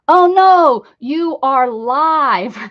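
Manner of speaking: loud, high gasp of dismay, dragged out slowly; stunned, slow oh no; surprised. Real, not acted. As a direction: caught off guard on air. Surprised at being live.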